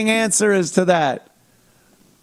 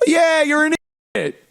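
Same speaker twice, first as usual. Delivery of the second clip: much higher, jeering voice; mocking fan; 11 semitones higher than usual. Fan jeers at the actor in a high mocking voice. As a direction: taunt him like a rude fan.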